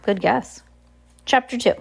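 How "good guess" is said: ordinary delivery, nothing marked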